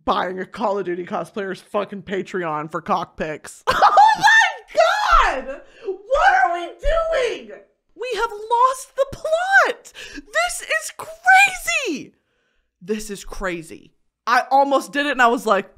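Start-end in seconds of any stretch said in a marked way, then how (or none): 3.8-12.1 very high, slow, drawn-out wailing scream. Real, not acted. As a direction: wail it out hysterically, stretching every word. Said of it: "Sorry for the screaming and the yelling and the crying".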